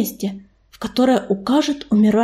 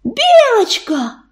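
high, drawn-out joyful call; 9 semitones higher, 3 dB louder, much slower than usual. A narrator's reading. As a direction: call the name across a distance. Father calls back to daughter. (протяжный высокий оклик)

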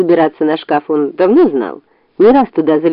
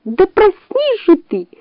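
high, urgent fox voice; fox pleading; 11 semitones higher than usual. A narrator's reading. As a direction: shake him awake, voice up high. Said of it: "громко кричать".

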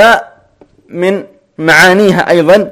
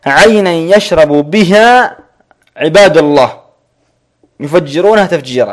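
Quran recitation; slower than usual. Shown as a demonstration. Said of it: "كقوله سبحانه وتعالى".

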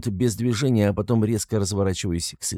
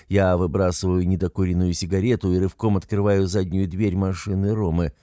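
usual speaking voice

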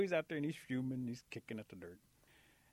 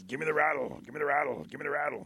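loud, identical forceful repeats; insistent bullfrog croaking orders; demanding bullfrog; 2 semitones higher, 9 dB louder than usual. Acted. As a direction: fire off the demand like a bossy frog.

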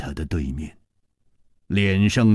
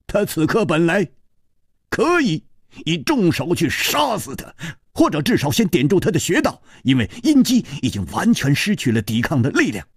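rising, urgent storyteller push; tense narration; 8 semitones higher than usual. A narrator's reading. Narrator building tension over Chu Liuxiang's choice. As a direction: press the pace as the action peaks.

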